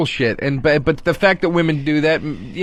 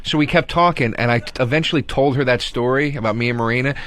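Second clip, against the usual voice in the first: ordinary talk, nothing marked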